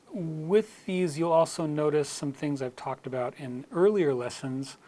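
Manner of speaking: ordinary talk, nothing marked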